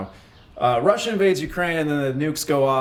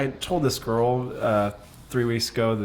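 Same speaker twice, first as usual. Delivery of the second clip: ordinary talk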